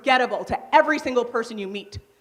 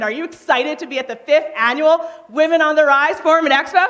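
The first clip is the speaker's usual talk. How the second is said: hyped-up, louder, higher crowd call; excitement; 4 semitones higher, 5 dB louder than usual. Shown as a demonstration. Coach rallying women at the forum, excited. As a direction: rev the room up, building to the last word. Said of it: "And then also excitement".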